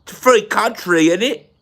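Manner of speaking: high, hammed-up London accent; exaggerated London accent, high and defiant; mock London accent. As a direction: play an indignant Londoner, swooping up in pitch. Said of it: "We talk like this".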